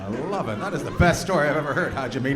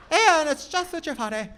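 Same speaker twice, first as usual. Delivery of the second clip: high mocking imitation; 14 semitones higher than usual. Mimicking audience member.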